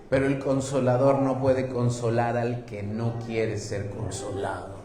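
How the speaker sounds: ordinary talk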